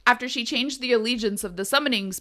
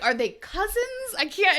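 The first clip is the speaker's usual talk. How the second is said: baffled reader; 11 semitones higher than usual. Retold or imitated. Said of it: "you're like".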